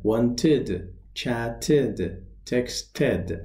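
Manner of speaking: "Wanted", "chatted", "texted" each in two careful syllables; ED as extra syllable. Shown as a demonstration. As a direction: model the ending in "Wanted", "chatted", "texted" as a clear id.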